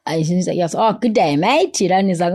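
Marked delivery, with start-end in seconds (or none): 0.8-1.8 put-on teasing Australian accent, higher; lightly hammed-up Aussie greeting; playful mock Aussie to a stranger. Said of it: "teasing this guy and saying".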